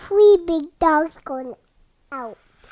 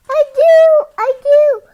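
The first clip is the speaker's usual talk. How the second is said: high, loud, happy agreement; delighted yes; 8 semitones higher, 9 dB louder than usual. Acted. Parent voicing a dog who loves the hat, delighted. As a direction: say it like finally won over.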